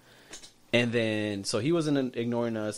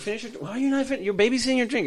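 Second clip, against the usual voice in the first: higher, faster mock nagging; persistent pusher; 13 semitones higher, 4 dB louder, faster than usual. Host nagging guest to finish her drink, high mock voice. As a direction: needle her about not drinking. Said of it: "That's so persistent".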